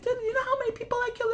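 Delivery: hammed-up outraged assassin; mock protest. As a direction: whine it up high and quick. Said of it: "you're like".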